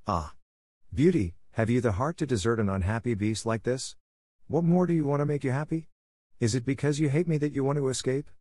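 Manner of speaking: mildly distressed beast, pitch lifting on the questions; miserable cry. A narrator's reading. Beast begging Beauty not to desert him, miserable. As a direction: plead in hurt questions, lightly acted. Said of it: "cried miserably".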